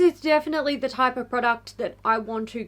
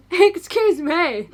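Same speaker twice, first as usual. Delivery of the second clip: laughing; 5 semitones higher, 6 dB louder, much slower than usual. Real, not acted. Laughing after sneeze joke.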